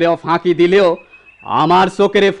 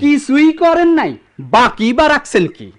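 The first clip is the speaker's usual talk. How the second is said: higher, biting retort; bitter sarcasm; 7 semitones higher, 4 dB louder than usual. Acted. Son accusing his father, sharp and sarcastic. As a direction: throw his words back at him.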